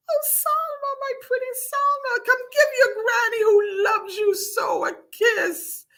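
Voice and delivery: put-on very high, crooning old-granny cry; Granny calling out. A narrator's reading. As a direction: call your granddaughter in a high, singsong voice. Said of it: "cried Granny".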